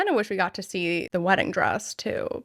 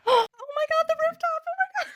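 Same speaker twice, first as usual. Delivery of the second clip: very high gasping fangirl squeal; excited squeal; faster than usual. Real, not acted. Fan spotting the rooftop illustration, very high squeal. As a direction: squeal with sudden delight.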